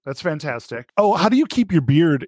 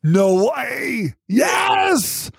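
excited shout; 10 semitones higher, much slower than usual. Real, not acted. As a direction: shout it out in real delight. Host thrilled at news.

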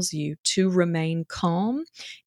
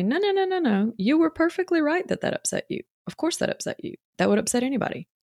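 higher, soothing reassurance that fades to a hush; reassuring; 8 semitones higher than usual. Retold or imitated. Coach reassuring a mom she was right to be upset, high and soothing. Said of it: "So if I come back and say".

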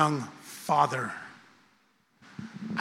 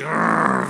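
a low, louder annoyed ugh; louder disgusted grunt; 5 semitones lower, 5 dB louder than usual. Retold or imitated. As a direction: grunt it out in frustration. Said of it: "I know how to be".

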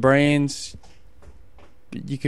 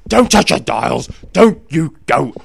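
radio sign-off; 4 semitones higher, 6 dB louder than usual. Acted. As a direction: boom it out like a TV host. Catchphrase sign-off.

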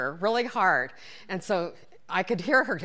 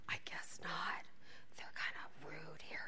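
whisper; 2 semitones lower, 15 dB quieter than usual. Retold or imitated. Friend whispering back during class, hushed whisper.

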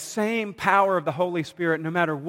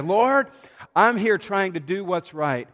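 crying out to God; 4 semitones higher, 3 dB louder than usual. Retold or imitated. Prayer voiced in the retold story.

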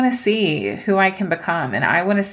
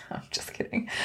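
breathy whispered joke; whispered aside; 15 dB quieter than usual. Real, not acted. Whispering to listeners that she's only joking. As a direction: drop to a whisper to take it back.

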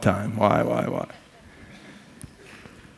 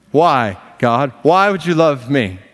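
bewildered plea; 4 semitones higher, 4 dB louder, slower than usual. Acted. Acting believer questioning God.

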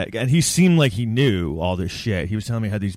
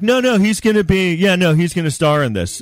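mock execs; 5 semitones higher, 4 dB louder than usual. Mocking the male execs.

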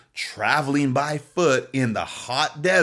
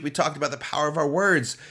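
ordinary talk

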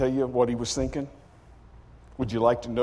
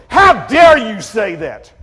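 loud, high, slow outraged cry; the priest explodes in indignation; 9 semitones higher, 13 dB louder, slower than usual. Acted. High priest tearing his clothes, roaring at Jesus. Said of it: "rips his clothes and goes".

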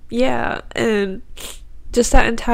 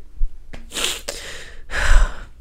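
long, heavy exhale to collect yourself; real tearful sigh; emotional sigh. Real, not acted. Speaker overcome by God's love, sighing. Collecting herself while crying.

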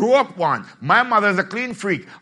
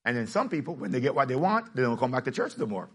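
ordinary delivery

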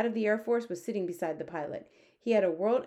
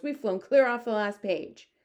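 loud, slightly raised complaint; raised, agitated voice; indignant cry; 4 semitones higher, 6 dB louder than usual. A narrator's reading. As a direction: cry out in exasperation. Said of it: "cried the head of the Air Force".

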